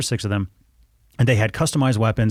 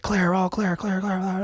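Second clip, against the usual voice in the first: mock screaming; 10 semitones higher, 4 dB quieter, slower than usual. Retelling trainees clearing rooms down a hallway, mock screaming. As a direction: imitate hallway screaming in a high, held-back voice. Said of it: "screaming down the hallway in English".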